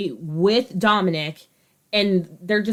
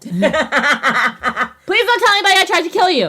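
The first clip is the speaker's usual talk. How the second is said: frantic mock begging; 8 semitones higher, 5 dB louder, much faster than usual. Joseph begging, mock panic.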